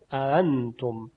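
انتم slowly as "aantum", second hamza softened towards a plain alif; between hamza and alif. Shown as a demonstration. Teacher repeating the tashil demo, sound between hamza and alif. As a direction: ease the opening of انتم into one gliding "a-a". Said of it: "با صدای بین همزه و الف".

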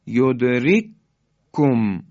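یدرککم with one firm doubled k; merged k. Shown as a demonstration. Teacher repeating the merge for students, firm doubled k. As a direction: recite یدرککم slowly, holding the k before releasing it.

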